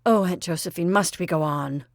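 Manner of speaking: ordinary delivery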